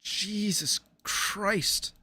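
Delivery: slow, soft awed groan; drawn-out hushed disbelief; stunned. Real, not acted. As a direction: let it drag out, quietly floored. Stunned by the huge dish.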